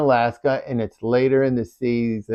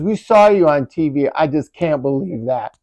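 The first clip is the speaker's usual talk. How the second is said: excited disbelief; 3 semitones higher, 7 dB louder than usual. Retold or imitated. Friend calling in amazement after seeing them on TV, louder. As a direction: marvel at the news out loud.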